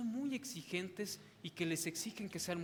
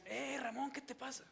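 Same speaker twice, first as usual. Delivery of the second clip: pitched-up, blunt challenge to a brother; rude confronting tone; 6 semitones higher, 4 dB quieter than usual. Shown as a demonstration. Preacher shows the wrong way to approach his brother, blunt and rude. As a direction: bark it at him disrespectfully. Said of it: "Yo no llegaría y le diría".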